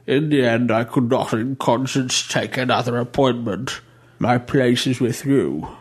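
a hint of a churchman justifying himself, slowing at the end; bishop's voice. Bishop Beasley explaining his return, lightly voiced and solemn.

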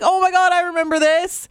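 high, delighted gasp of memory; suddenly thrilled to recognise it; excited recognition. Retold or imitated. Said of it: "You're like".

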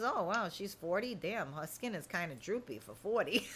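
judgy onlooker aside. Acted. Onlooker sizing up a woman who claims forty, judgy aside. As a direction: drop it into a catty aside. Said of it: "looks at you and goes".

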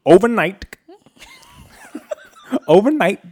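high laughing overnight. Real, not acted. Laughing through the word.

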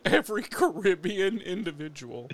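real laughter through the words; co-host laughs while repeating the accusation; laughing. Real, not acted. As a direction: chuckle it out.

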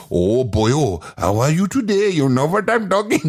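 lightly voiced Pakistani accent; a hint of a Pakistani accent. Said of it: "into a Pakistani accent".

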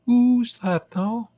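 put-on high, loud, drawn-out English accent. A narrator's reading. Old neighbour startled in the store, high English accent. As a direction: voice an English lady in a high register. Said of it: "said in her perfect English accent".

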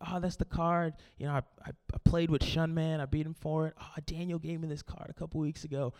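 soft, low mock-weepy mumbling; acted grief, voice sinking away; tearful lament. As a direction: mourn each card, fading almost to nothing. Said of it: "tears running down my face".

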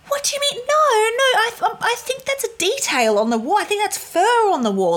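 high, squeaky dismissive voice; mocking boyfriend. Mocking the boyfriend who excuses the cobwebs, squeaky. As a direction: mimic him in a silly high squeak. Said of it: "my boyfriend was like".

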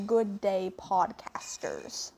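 slow Aussie greeting, a little British; attempted Australian accent. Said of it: "Did I sound Australian enough?".

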